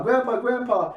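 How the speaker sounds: high-pitched little puppy voices; excited puppies. Retold or imitated. Puppies crowding round their grandpa, high and excited. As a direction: yap it like pups calling an elder. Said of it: "they're all barking around their grandpa".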